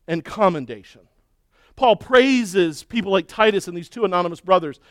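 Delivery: ordinary talk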